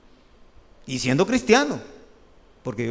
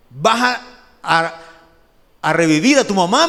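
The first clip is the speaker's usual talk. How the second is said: boss scolding; 2 semitones higher, 8 dB louder than usual. Retold or imitated. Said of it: "me dijo".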